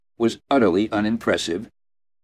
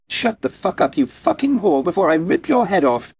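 much higher, sudden furious outburst; roaring fury; 9 semitones higher than usual. A narrator's reading. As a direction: explode at her out of nowhere. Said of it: "she roared at Miranda".

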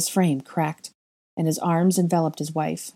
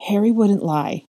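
slightly higher, firmer statement; forcing it louder; 4 semitones higher, 3 dB louder than usual. A narrator's reading. Girl defending Harry to grieving parents, louder. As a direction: push the words out past the lump. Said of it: "fought to speak louder".

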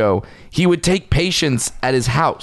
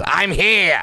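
Gilbert Gottfried voice; 7 semitones higher than usual. Retold or imitated. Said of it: "Gilbert Gottfried ... just goes".

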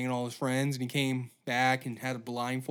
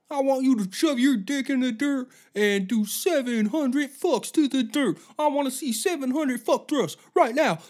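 high barking drill sergeant yell; 10 semitones higher, 6 dB louder than usual. Acted. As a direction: scream orders at recruits. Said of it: "Imagine being a fucking drill sergeant".

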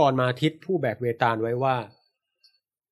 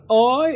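rhythmic cry of pain; 9 semitones higher, 4 dB louder, slower than usual. Retold or imitated. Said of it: "หวีดร้องขึ้นมาเป็นจังหวะแบบเสียงแบบอย่างเงี้ย".

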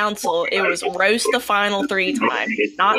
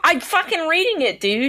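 higher-pitched, irritated comeback; exasperated retort; 7 semitones higher than usual. Real, not acted. Player told to read it himself, exasperated. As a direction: push back, fed up at being nagged.

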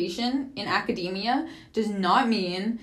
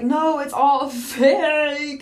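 drawn-out mock of the friend, slightly louder; slow dismissive mimic; 5 semitones higher, 5 dB louder, much slower than usual. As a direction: imitate her brushing it off, slow and scornful. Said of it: "she's like".

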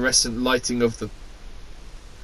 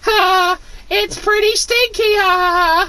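silly high voice; 4 dB louder, slower than usual. Acted. Reading a silly character aloud.

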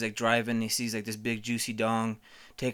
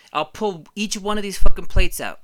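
shouted, higher and much louder; hammed-up furious threat; mock threatening shout; 6 semitones higher, 12 dB louder than usual. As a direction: yell the threat at her. Threatening to smash plates.

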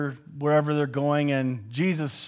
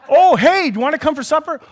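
much higher and louder eager call; eager invitation; 11 semitones higher, 11 dB louder, faster than usual. Retold or imitated. Travelers calling Jesus back, high and eager. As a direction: call him back to supper.

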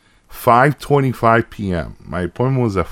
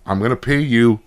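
ordinary delivery

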